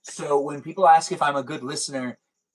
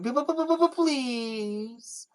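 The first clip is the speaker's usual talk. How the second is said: high stuttered whine; squeaky stammering plea; begging please; 12 semitones higher than usual. Retold or imitated. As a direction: beg again, higher and more desperate. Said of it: "And then".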